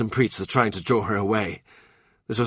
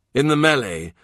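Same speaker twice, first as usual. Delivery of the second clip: ordinary delivery, nothing marked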